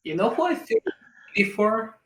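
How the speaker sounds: light Latin accent; lilting Latin-accented question. Real, not acted. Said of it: "you're saying it in a Latin accent".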